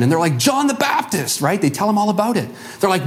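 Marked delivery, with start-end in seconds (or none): 0.4-1.4 lightly voiced eager follower. Followers answer Paul.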